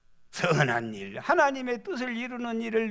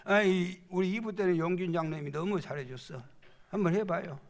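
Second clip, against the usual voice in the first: usual speaking voice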